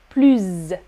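"plus" as pluzz, buzzed ending; final Z sound. Shown as a demonstration. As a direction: say "plus" falling, with a mosquito Z. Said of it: "Comme un moustique".

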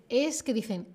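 ordinary talk

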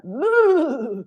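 hennissement imité, un peu ri; imitation de cheval. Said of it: "on va essayer de faire le cheval". (hennissement de cheval pour rire)

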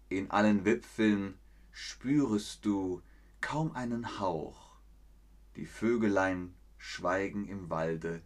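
slow, hushed recital with long pauses between phrases; poem recitation. Shown as a demonstration. Reciting Goethe to the stream, slow and hushed. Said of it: "ich werde es rezitieren".

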